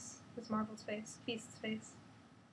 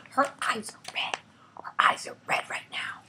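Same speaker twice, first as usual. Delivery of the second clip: whisper. Acted. Whispered aside in comic reading.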